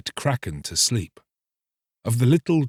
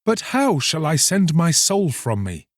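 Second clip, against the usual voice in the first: raised, urgent question; eager cry; 7 semitones higher than usual. Acted. Fisherman cries out to the mermaid, urgent. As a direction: plead for the answer. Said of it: "cried the young fisherman".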